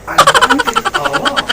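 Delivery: laughing. Real, not acted. Chef replies playing along.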